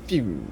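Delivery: high "pew" gliding down; imitated flying debris. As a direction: send the pew sliding down like something flying off.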